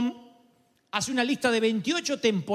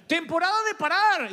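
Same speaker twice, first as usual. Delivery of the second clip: mock announcement; 9 semitones higher than usual. Preacher announcing a change to someone oblivious, mock announcement. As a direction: proclaim the new season over their heads.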